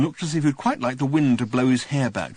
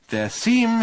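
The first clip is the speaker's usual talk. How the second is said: higher, louder patient overpronouncing; slow and distinct; 13 semitones higher, 5 dB louder than usual. A narrator's reading. Ford explaining to Arthur, slowly and distinctly. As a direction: spell it out like to a slow clerk. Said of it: "as slowly and distinctly and patiently".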